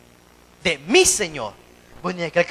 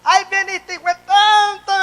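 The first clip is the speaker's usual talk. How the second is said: mock insulting stadium fan; 11 semitones higher, 7 dB louder than usual. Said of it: "Y ahí no nos enojamos".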